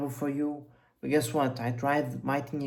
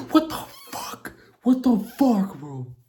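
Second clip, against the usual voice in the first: high, half-whispered then loud what the fuck; stunned disbelief; 7 semitones higher, 6 dB louder than usual. Real, not acted. Reacting in shock to a classmate's follower count, disbelieving.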